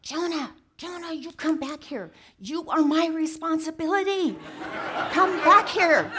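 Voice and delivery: put-on high, loud frantic yell at a runaway toddler; imitating a four-year-old shouting; mock yell. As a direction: call him back like a panicked big brother. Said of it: "screaming at him".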